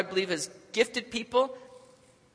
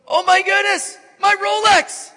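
loud, high-pitched wail of dismay; horrified mock yell; 14 semitones higher, 11 dB louder than usual. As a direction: cry out in comic horror over a precious loss. Joke punchline, man acts horrified.

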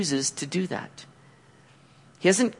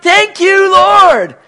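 high, loud cry of gratitude; exultant mock-captive jubilation; overjoyed thanks; 14 semitones higher, 11 dB louder, much slower than usual. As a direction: shout your thanks up to the Lord. Acting out overjoyed returned captives.

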